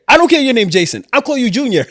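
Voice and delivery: a hint of a loud, bossy manager; lightly voiced gruff boss insisting.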